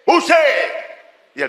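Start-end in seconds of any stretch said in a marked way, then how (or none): 0.1-0.4 llamada aguda. Acted. Llamando al perro, grito agudo.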